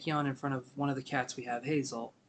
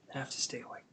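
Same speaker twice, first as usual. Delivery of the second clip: whispered to herself, softer; 7 dB quieter than usual. Real, not acted. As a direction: breathe it out under your breath. Tired reader urging herself on.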